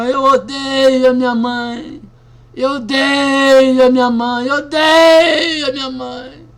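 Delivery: slow, loud, breaking into tears; an anguished woman's cry of hate; bitter outburst. Retold or imitated. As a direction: spit out the hatred as the sobs come. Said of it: "começou a mudar o jeito dela".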